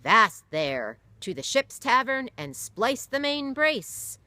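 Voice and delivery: loud commanding captain's call; roared. Acted. Captain roaring orders to stop the fight, loud. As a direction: stop the brawl with a bark. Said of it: "Captain John Derby roared".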